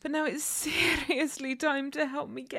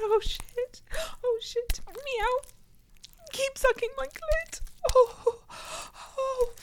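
higher excited moans; 7 semitones higher than usual. Acted. Rising arousal, pitch up.

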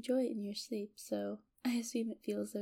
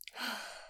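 long, quiet exhale; soft sigh; 6 dB quieter than usual. Acted. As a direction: breathe out softly before a confession. Before confessing love.

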